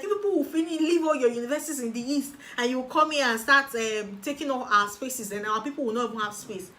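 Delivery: a hint of the indignant office woman; lightly voiced resentful complaint; mock hostile official. Office woman complaining about Easterners taking spaces, mildly hostile. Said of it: "she was so hostile to me".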